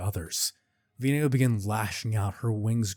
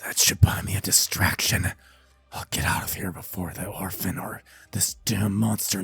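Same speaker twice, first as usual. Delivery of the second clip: low, half-whispered scheming; rasped aside to himself; villain muttering; 3 semitones lower than usual. Acted. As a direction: mutter it under your breath. Villain schemes to himself while escaping.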